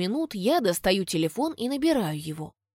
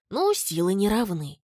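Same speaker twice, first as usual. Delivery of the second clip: ordinary delivery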